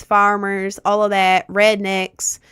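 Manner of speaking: ordinary talk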